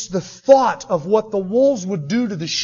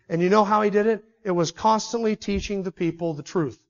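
normal talking voice

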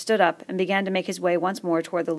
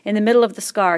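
plain reading voice

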